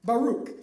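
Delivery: "Baruch" ending in "ook"; corrected name. Shown as a demonstration. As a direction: say "Baruch" as "buh-ROOK".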